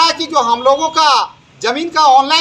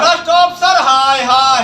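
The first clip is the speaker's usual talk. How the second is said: shouted rally jeer; protest slogan call. Real, not acted. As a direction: jeer it to the crowd. Leader calls out the slogan.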